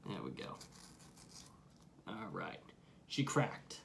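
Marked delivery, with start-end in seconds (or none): 0.1-2.6 soft breathy whisper under the breath. Real, not acted. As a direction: whisper while fiddling with the lid. Opening the can up close.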